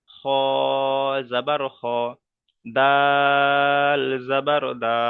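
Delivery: "জিম" ও "দাল" লম্বা টেনে, মাঝে থেমে বানান করে পড়া; টান দিয়ে পাঠ. Shown as a demonstration. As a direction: draw out "দাল" and the closing "দা" in a measured spelling recitation. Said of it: "তাহলে এইভাবে আমরা উচ্চারণ করব". (অক্ষরে টান দিয়ে "দাল জাবার দা" উচ্চারণ)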